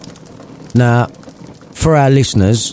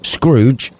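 ordinary delivery, nothing marked

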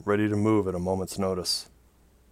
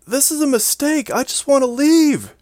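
very high, shouted panic; frantic protest; 9 dB louder than usual. A narrator's reading. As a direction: scream it at the comm. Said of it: "she protested".

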